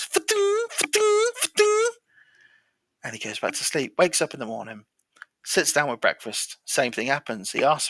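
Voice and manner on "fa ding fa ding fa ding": very high ringing noise, sung out; imitated strange noise. Storyteller imitates the noise heard at night, high and ringing. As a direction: mimic a repeated high ding on one held pitch. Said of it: "same noise".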